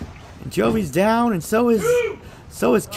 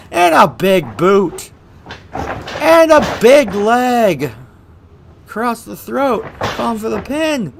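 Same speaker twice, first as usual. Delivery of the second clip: normal talking voice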